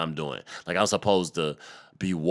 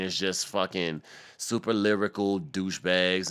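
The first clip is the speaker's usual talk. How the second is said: normal talking voice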